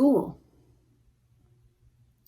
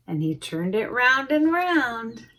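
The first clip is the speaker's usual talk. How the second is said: plain reading voice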